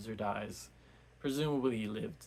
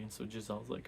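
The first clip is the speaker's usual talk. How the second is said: ordinary delivery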